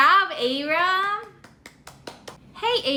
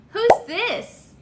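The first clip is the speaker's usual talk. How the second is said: ordinary talk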